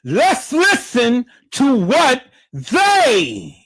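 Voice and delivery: emphatic announcing. Real, not acted. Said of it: "hyping up the clip".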